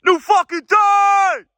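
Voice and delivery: very high, drawn-out shout; screaming for echo. Real, not acted. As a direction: bellow it slowly for the echo. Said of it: "whatever the fuck I was screaming".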